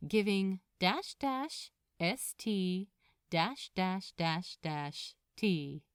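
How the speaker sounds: ordinary delivery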